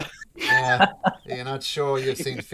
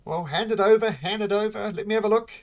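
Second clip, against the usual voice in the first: dwarf shopkeeper; 10 semitones higher, 7 dB louder, faster than usual. Acted. Shopkeeper asks for the stone.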